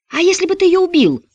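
high, loud accusing cry; shrill shout. Acted. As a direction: yell it at him.

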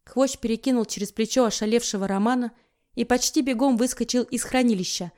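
ordinary delivery

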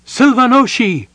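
loud, high desperate call; calling his name. Acted. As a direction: shout his name to wake him. Shaking him awake.

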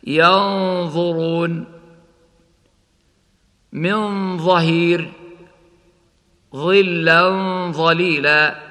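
slow ينظرون, humming the hidden ن before ظ; ikhfa before ظ. Shown as a demonstration. Teacher demonstrating ikhfa before ظ, slow recitation. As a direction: recite ظلا ظليلا with the tanween drawn out. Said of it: "هكذا".

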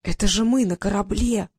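usual speaking voice

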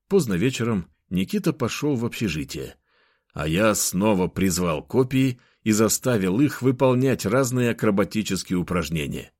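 ordinary delivery, nothing marked

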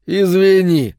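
high, loud mock apology; sugary, insincere sorry; fake sympathy. A narrator's reading. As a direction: apologise with exaggerated false pity. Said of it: "притворно посочувствовал бык".

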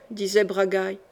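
ordinary delivery

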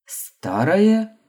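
loud baffled «Старое?» rising high; puzzled question. A narrator's reading. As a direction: ask «Старое?» in loud confusion. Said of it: "опять не понял меня декан".

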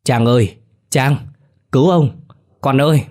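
put-on old man yelling to Trang for help after seeing a ghost. A narrator's reading. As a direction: cry out for rescue at the top of the voice. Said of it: "miệng la lớn".

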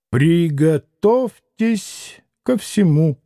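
slow, each syllable of Приготовьтесь ко всему struck separately; clipped every syllable. A narrator's reading. Doctor warning the father, slow syllable-by-syllable stress. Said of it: "отчеканил, ударяя по каждому слогу".

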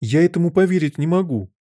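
high-pitched disbelief; astonished. A narrator's reading. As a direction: protest that it is unbelievable. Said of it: "воскликнула Алиса". (изумлённо, высоким голосом)

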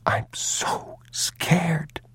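put-on very slow, high, whispery child; small, soft, drawn-out fear; scared boy. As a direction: whisper it like a frightened little boy. Scared boy voiced in story.

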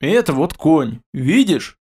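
ordinary delivery

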